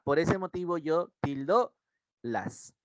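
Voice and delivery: normal talking voice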